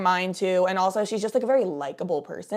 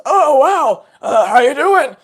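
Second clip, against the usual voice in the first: loud, higher mock-dad voice; big put-on dad voice; imitating dad; 6 semitones higher, 11 dB louder, slower than usual. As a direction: boom out an exaggerated dad greeting. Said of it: "my dad's voice is like this all the time".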